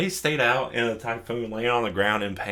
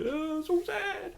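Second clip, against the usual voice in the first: mock sad falsetto; 5 dB quieter, slower than usual. Mocking a character's sad reaction.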